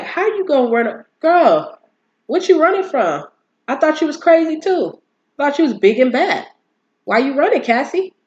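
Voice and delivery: high, loud call-out at a runaway; loud, high mock disbelief; incredulous taunting. Reacting to Cassie running away.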